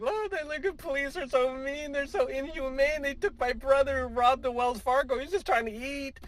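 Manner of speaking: hammed-up high voice of a complaining sister; mock whiny sister. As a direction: plead the robber's case in a silly high whine. Mocking sister defending robber brother.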